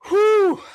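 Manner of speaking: a big, high puff of breath out; winded exhale. Real, not acted. Streamer catching his breath mid-rant, loud winded exhale. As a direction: blow out air like you're winded. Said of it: "I don't know why I'm getting out of breath".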